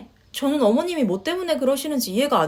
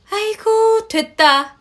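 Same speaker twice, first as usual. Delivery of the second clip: exasperated scoff; 9 semitones higher, 4 dB louder, much slower than usual. Acted. Mother scoffs at the daughter-in-law's offer.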